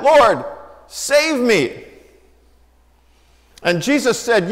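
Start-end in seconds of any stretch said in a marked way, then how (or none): 0.0-1.8 desperate cry. Acted. Peter crying out while sinking.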